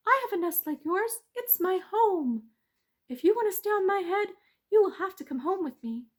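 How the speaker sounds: high, loud tricky mouse. Acted. Mouse tricking the bees, high and sly.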